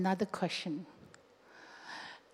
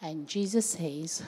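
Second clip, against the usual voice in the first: ordinary delivery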